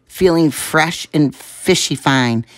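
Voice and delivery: plain reading voice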